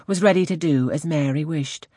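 ordinary delivery

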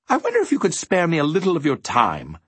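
high, quick, genteel gentleman; polite inquiry. A narrator's reading. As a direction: ask a favor ever so politely. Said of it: "he inquired politely".

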